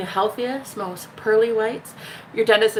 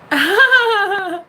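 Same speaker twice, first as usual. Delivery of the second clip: high, loud drawn-out laugh; real laugh; 11 semitones higher, 6 dB louder than usual. Real, not acted. Host delighted by a comment, laughing high.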